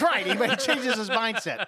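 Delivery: laughing. Real, not acted. Joking about character's change.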